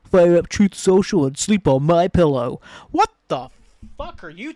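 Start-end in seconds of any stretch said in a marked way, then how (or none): 0.1-2.6 mocking country singer. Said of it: "the dude from Big and Rich is like".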